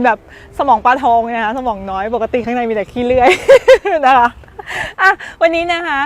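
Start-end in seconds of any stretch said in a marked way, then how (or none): 3.4-4.0 high burst of laughter. Real, not acted. Laughs at own joke.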